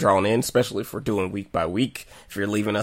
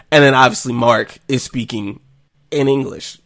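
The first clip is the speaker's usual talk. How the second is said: usual speaking voice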